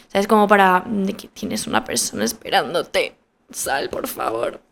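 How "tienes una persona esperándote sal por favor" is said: low, hushed pointed nudge; discreet prodding. Retold or imitated. Nudging a coworker to come out to the waiting visitor, discreet prodding. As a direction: drop down low and slow into a discreet plea.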